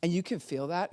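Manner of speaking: ordinary delivery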